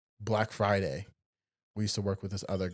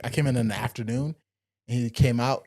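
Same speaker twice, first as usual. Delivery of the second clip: ordinary talk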